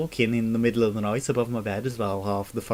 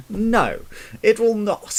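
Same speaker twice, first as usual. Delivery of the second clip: emphatic no; 9 semitones higher, 5 dB louder, slower than usual. Real, not acted. Answering own rhetorical question emphatically.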